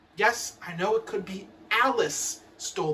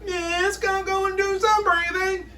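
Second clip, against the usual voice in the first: put-on high whining child; whiny kid; 9 semitones higher than usual. Whiny kid begs the teacher, high whine. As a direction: whine like a pleading pupil. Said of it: "The whiny ones".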